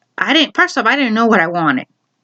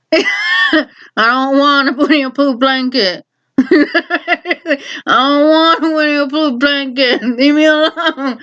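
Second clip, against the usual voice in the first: slow, higher-pitched mock whine, breaking into laughs; fed-up child whine; 5 semitones higher, slower than usual. Child refusing mom's offer of a blanket, mock whining. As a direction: drag out a hammed-up kid's protest.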